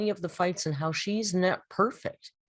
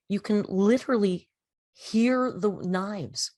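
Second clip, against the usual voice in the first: ordinary talk, nothing marked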